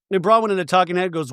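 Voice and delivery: ordinary delivery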